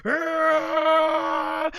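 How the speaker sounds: high strained Ah; straining yell. Retold or imitated. Goku holding the beam, strained yell. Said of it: "Goku's like".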